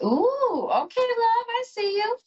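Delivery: thrilled at what the cards show; squealing with delight; excited gush. Real, not acted. Excited reaction to drawn tarot card.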